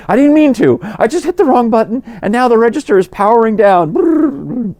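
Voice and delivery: high, loud panicked apology; flustered barista. Retold or imitated. Panicked barista apologizing for shutting down the register. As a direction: squeal it out like a panicking young barista.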